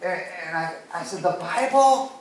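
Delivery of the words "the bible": high, loud, disbelieving retold question; incredulous question. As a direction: blurt the guess in astonishment. Incredulous guess at what he wants.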